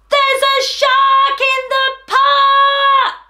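high, slow, sung-out shout. A narrator's reading. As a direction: shout the warning out loud. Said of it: "What do you think he's about to shout?".

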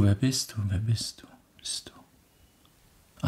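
fades down into a whisper; fading echo. A narrator's reading. Echo repeats the question, fading out. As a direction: let the echo die away. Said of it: "antwortete das Echo".

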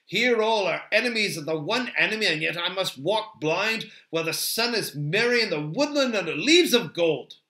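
impassioned, aggrieved elf; lamenting Legolas. Acted. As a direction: protest the folly with feeling. Legolas laments being blindfolded.

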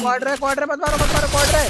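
loud, high, urgent calling out to the team; urgent yell. Real, not acted. Player yelling to teammates during a fight, loud urgent shout. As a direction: shout it over the gunfire, desperate.

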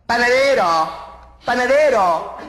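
drawn-out, high, loud call; campy high-pitched summons. Acted. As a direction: sing out to the baker, high and loud.

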